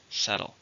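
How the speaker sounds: "Settle" said SET-tle, first syllable stressed. Shown as a demonstration. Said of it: "stresses in the first".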